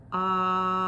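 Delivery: long sustained loud ahh; held ah sound. Shown as a demonstration. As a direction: hold a steady ah for breath support. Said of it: "we do an ah sound and we hold".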